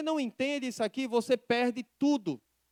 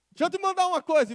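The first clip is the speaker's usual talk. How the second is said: higher, fast quoted friend's voice; eager friend quoted; 8 semitones higher, 4 dB louder, much faster than usual. Retold or imitated. Friend quoted sending a post. (amigo empolgado citado, mais agudo e rápido)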